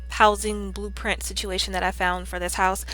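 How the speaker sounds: ordinary talk, nothing marked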